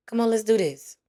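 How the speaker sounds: usual speaking voice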